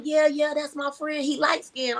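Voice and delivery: high-pitched eager confirmation, retold; imitating excited kid. Boy confirming he knows her son, imitated excitement. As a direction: play a boy going on about his friend. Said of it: "he starts, like, kinda going in".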